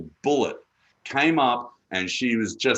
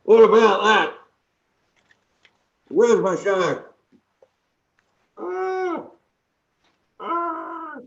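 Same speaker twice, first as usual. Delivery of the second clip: muffled behind a mask; 8 semitones higher, 4 dB louder, much slower than usual. Acted. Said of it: "It's hot in there".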